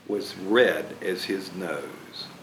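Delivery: soft, slow storyteller close; gentle. A narrator's reading. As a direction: ease it down tenderly. Soft slow story ending line.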